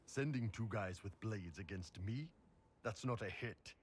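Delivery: ordinary talk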